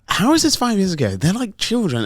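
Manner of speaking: mock astonishment at the old photo; retold shock at how the kids grew; surprised. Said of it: "I was like".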